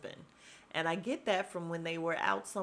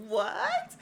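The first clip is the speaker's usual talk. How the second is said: high, incredulous mock "what?"; shocked what; 4 dB louder than usual. Everybody reacting to the gossip, shocked. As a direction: squeal it in disbelief.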